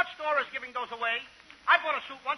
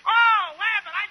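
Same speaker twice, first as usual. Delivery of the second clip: excited whine; 7 semitones higher, 7 dB louder, slower than usual. Acted. Costello's high excited greeting.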